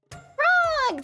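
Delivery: very high squealed name; high, happy exclamation; excited greeting. Real, not acted. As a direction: call the name out in delight.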